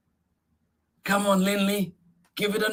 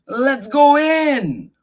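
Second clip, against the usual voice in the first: ordinary delivery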